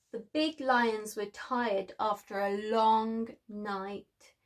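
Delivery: ordinary delivery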